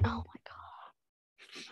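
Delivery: slow breathless whisper. Retold or imitated. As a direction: whisper it, utterly smitten. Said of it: "it's like".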